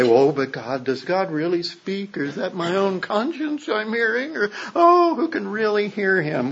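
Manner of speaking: mock the skeptics with a rising plaintive whine; a hammed-up, climbing skeptic's whine; imitating skeptics. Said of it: "some of the skeptics say".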